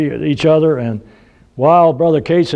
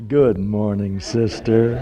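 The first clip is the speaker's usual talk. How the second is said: lower, composed greeting put on for show; sudden sweet politeness; 4 semitones lower, 4 dB quieter, slower than usual. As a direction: drop the pitch into a pious, friendly hello. Switches to polite greeting when seen.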